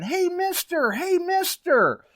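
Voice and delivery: put-on very high, excited kid's holler. Kid on the shore calling a water hunter, hollering. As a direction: imitate a kid calling from a distance, stretched out.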